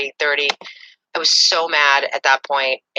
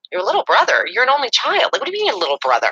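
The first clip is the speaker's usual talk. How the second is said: high, disbelieving questions; incredulous; 8 semitones higher than usual. Retold or imitated. Girlfriend questioning boyfriend's story, high incredulous disbelief. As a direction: sound floored by the obvious lie. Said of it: "And I was like".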